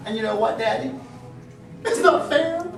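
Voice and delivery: put-on high sulky little girl; whiny child. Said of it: "my daughter Emily speaking".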